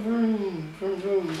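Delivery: playful car vroom; engine noise. Pretend. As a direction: make engine sounds for the spoon. Airplane spoon feeding.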